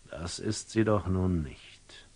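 breathy whisper to himself; whispered aside. Acted. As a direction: let it slip out as a whispered thought. Brooding aside in a monologue.

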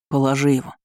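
ordinary delivery, nothing marked

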